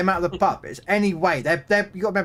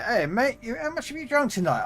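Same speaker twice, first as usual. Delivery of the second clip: friendly bouncer chat; 9 semitones higher than usual. Retold or imitated.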